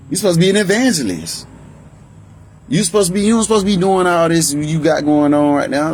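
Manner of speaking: high-pitched indignant scolding; retold righteous rebuke. Child telling mama about herself as God speaks through, indignant retold rebuke. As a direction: call her out, pitched well up. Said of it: "That was God speaking through me and telling my mama about herself".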